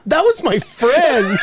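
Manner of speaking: high, slow retold protest; imitated hurt objection, played for laughs; mock indignant protest. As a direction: draw it out high, on the edge of laughter.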